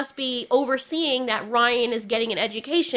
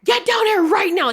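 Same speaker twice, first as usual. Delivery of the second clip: higher and louder mock call from mom, you're in trouble; mom calling angrily; 7 semitones higher, 5 dB louder than usual. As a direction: yell down the stairs like an angry mom. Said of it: "the way she's calling you're in trouble".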